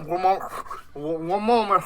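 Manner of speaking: lightly voiced hero stalling mid-bite; half-hearted mock formality, mouth full; in-character mouthful. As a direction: pretend to hold up a finger while chewing. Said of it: "I'll be like this".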